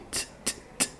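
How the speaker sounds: very soft tongue clicks "t", no voice; voiceless T sound. Shown as a demonstration. Teacher demonstrating the sound of T, unvoiced ticks. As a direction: release bare T's with breath only. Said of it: "Esse é o som do T".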